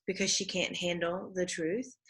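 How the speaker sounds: ordinary talk, nothing marked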